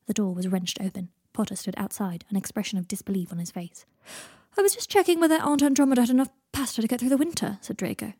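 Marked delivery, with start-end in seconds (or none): 4.6-7.6 higher, airily casual excuse; breezy cover-up. A narrator's reading. Draco, caught hiding in the pantry, breezily excuses himself. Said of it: "breezing past him".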